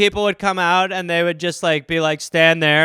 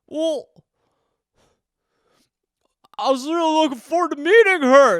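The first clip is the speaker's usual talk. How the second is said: high, plaintive whine; crushed man-child; 9 semitones higher, 5 dB louder than usual. Acted. Grown son mourns Tooth Fairy, crushed. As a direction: lament like a let-down kid.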